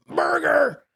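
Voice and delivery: high, hollered order; hammed-up high yell; counterman's yell. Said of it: "disgusting guy behind the counter yells".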